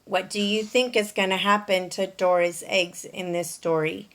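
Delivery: usual speaking voice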